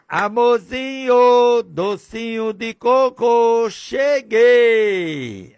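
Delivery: high, loud, drawn-out sugary call; doting husband voice. Retold or imitated. Preacher acts a husband sweetly calling his wife at the gate. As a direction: sing it out sweetly like a lovesick husband.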